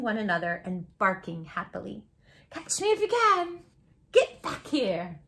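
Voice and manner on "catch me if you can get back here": playful. Acted. Puppies chasing, playing.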